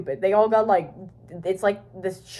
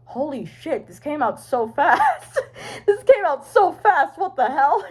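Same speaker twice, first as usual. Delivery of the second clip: high, laughing mock disbelief; retold amazement; 6 semitones higher than usual. Fan retelling how fast the episode came out, laughing amazement. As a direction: replay being astonished, rising into laughter.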